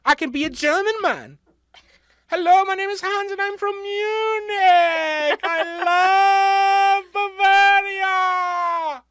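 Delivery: high, loud, hammed-up German; mock German accent. Podcaster posing as German man Hans from Munich, loud high German accent. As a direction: shout a comic German accent, stretching the words out. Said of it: "Do you want me to talk in German?".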